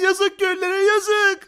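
high-pitched lamenting; hammed-up sorry tut; mock pity. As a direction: wail in pity for the villagers. Pities hanged villagers.

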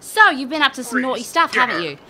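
smug, loud mock scolding; gloating taunt. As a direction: needle him like a cat with a mouse. Taunting the minister.

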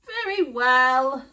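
a hint of a frail granny; old woman voice. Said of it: "said the old woman".

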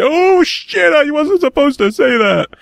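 mock slip-up, panicking in a high voice; hammed-up high oops voice; imagined accidental blurter. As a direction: act out blurting a secret, then squeal in alarm. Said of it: "They'll just fuck up and be like".

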